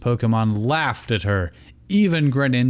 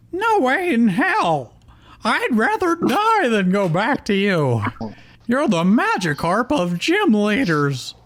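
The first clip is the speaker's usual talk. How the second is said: sneering Starmie; 12 semitones higher than usual. A narrator's reading. Starmie rejecting Misty with a high sneer. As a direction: spit contempt at the old trainer. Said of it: "Starmie sneered".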